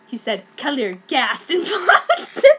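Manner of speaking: usual speaking voice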